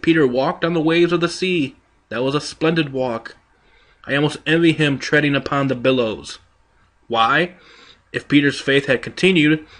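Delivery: ordinary delivery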